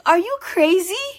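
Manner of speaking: high, loud disbelieving cry; shocked. Retold or imitated. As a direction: blurt it out in shock.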